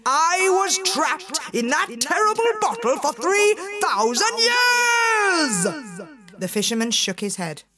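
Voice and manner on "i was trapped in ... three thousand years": genie shaking with rage. Acted. Furious genie shouts at the doubting fisherman, enraged shout. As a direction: bellow it, outraged. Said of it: "began to shake with rage".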